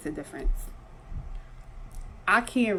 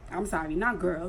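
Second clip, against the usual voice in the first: usual speaking voice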